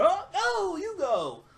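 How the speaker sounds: very high, squeaky mock-polite voice; mock falsetto. Fan mocking Reigns's routine move, squeaky falsetto. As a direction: camp it up in a silly high voice.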